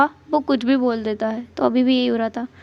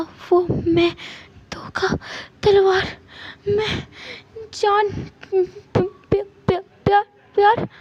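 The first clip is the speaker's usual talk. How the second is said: higher, stammering broken words; frightened fragments; 6 semitones higher, slower than usual. Acted. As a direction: gasp out scraps of a nightmare. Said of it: "wing बहुत ज़्यादा डरा हुआ लग रहा है". (डरकर टूटे-फूटे शब्द)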